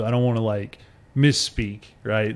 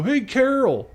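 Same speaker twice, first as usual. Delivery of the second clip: calling out; 12 semitones higher, slower than usual. Retold or imitated. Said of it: "you hear somebody go".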